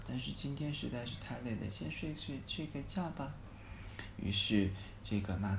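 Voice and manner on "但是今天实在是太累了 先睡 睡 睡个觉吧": much softer, drowsy murmur drifting off; sleepy. Acted. Tired boy in bed at night, drowsily fading off. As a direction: let the words fade into sleep. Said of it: "今天他真是太累了".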